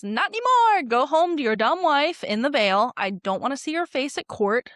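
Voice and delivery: hammed-up king yelling at his brother; angry shout. The king banishing his brother, loud mock furious shout. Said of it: "And Viserys says".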